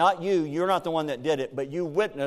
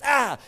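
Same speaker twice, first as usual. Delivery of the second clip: mock angry cry; 10 semitones higher, 6 dB louder than usual. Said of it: "they go like this".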